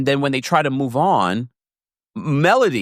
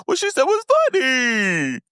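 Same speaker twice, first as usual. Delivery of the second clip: high imitated voice; 12 semitones higher than usual.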